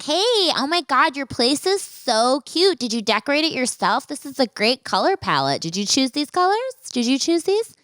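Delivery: high, gushing campy chatter. Retold or imitated. Date complimenting her home, mocked in a high effeminate voice. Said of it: "more feminine than I did".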